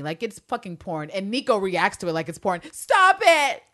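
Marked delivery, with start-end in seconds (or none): 2.7-3.6 high, loud "stop it"; hammed-up outraged cry; mock scandalised shout. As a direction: shriek it in playful disbelief. Hammed reaction to the scene.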